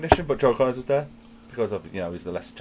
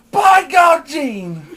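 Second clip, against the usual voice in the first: much higher and louder, slow drawn-out yell; fan shout; 14 semitones higher, 10 dB louder, much slower than usual. Retold or imitated. Friend joining in yelling at the signing. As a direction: bellow it from the crowd. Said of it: "[name] joins in going".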